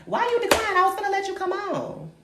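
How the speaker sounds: high fake-offended whine; high mock outrage.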